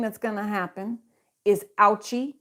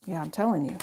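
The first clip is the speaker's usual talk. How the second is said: usual speaking voice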